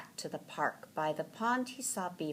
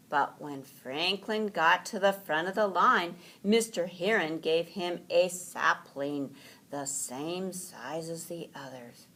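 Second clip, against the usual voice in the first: ordinary delivery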